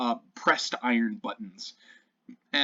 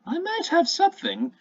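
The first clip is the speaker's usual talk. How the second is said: high, coy shopkeeper voice, much higher; sly shopkeeper; 9 semitones higher, 7 dB louder than usual. Acted. Shopkeeper teasing the party about the panther. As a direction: purr it like a flirty merchant.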